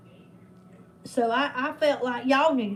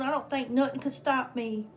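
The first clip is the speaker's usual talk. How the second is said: ordinary talk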